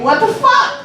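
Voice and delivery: shocked high what the fuck. Retold or imitated. Comic recalling reaction to the baby's name, shocked. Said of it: "I was like".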